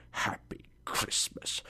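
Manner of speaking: whispered mock greeting; scornful whisper. A narrator's reading. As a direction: hiss the greeting with contempt. Scrooge mocks the greeting.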